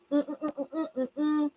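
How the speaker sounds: normal talking voice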